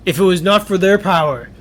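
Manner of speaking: father character. Acted.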